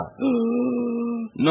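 drawn-out, high imitated gasp; mock gasp for breath. Patient struggling to refuse the nurse, mock gasping. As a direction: labour for air before refusing. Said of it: "lying there gasping for breath".

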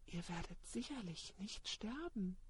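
hushed, distant snake voice; serpent voice. Retold or imitated. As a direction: murmur it like the serpent. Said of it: "Da sprach die Schlange zum Weib".

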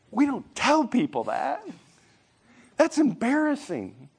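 high-pitched correcting parent; embarrassed parent. Acted. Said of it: "And they're like".

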